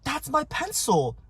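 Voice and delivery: high, drawn-out whiny exclamation; slow, high-pitched put-on kid cry; mock outraged kid. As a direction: imitate a child's indignant discovery. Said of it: "And then William goes".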